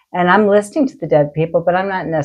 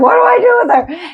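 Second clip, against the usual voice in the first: very high, louder panicked cry; healer's frantic plea, pitched way up; desperate plea; 4 dB louder than usual. Retold or imitated. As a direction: squeal it out in helpless panic. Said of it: "I'm like".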